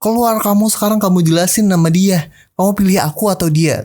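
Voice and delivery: mild mock outburst; mock angry demand. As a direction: imitate the rival guy picking a fight. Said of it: "si cowok itu ngegas-ngegas dong".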